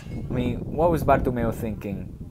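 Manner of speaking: ordinary talk, nothing marked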